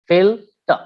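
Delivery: "filter" split as fil, ter with a gap; syllable by syllable. Shown as a demonstration. Teacher showing a disyllabic noun, syllable by syllable. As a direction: pause between the two syllables of "filter". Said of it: "disyllabic nouns".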